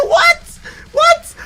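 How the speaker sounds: very high startled squeak; shocked high what. Real, not acted. Streamer shocked by new fans, high shocked squeak. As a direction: squeak out the disbelief.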